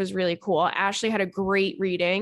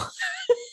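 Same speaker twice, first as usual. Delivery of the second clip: high-pitched laugh; cracking up. Real, not acted. Cracking up after quoting mom to listeners, high laughter. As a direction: burst into a high giggle.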